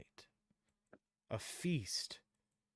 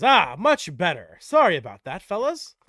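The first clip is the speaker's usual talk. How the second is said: hearty guard apologizing to the fellas; GM voicing a guard; 8 semitones higher, 18 dB louder than usual. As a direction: boom it out like a relieved soldier. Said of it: "And he says".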